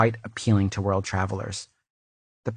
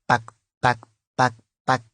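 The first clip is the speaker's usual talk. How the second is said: clog sounds in her own lower voice; lower clog clacks. Acted. Redoing the clog noise after the shrill take, lower. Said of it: "I'll do it lower".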